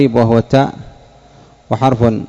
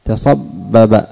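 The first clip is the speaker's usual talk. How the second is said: تصبب drawn out slowly, syllable by syllable; deliberate teacher's dictation of تصبب; much slower than usual. Shown as a demonstration. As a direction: spell out تصبب slowly for the class. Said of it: "انظر، تصبب".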